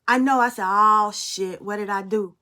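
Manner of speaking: usual speaking voice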